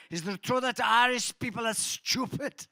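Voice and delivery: put-on high, loud German-accented question. To the crowd, a German seatmate quizzing him, German accent. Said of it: "it had a German accent".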